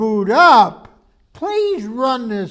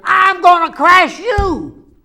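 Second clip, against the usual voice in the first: higher, louder threat; threatening outburst; 7 semitones higher, 4 dB louder than usual. Acted. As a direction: snap it at the machine. Owner threatens computer.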